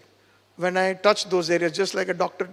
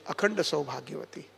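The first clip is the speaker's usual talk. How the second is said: Akhanda Saubhagyavati said as a much softer, lower blessing; 6 semitones lower, 10 dB quieter, faster than usual. Shown as a demonstration. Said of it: "give that benediction".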